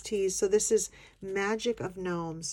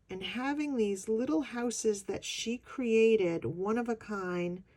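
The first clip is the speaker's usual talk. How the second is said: usual speaking voice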